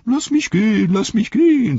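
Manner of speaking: high, loud, desperate begging; a cornered coward crying to be let go; Wormtongue pleading. Acted. Wormtongue begs Treebeard in a high, loud voice. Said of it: "sagte Schlangenzunge".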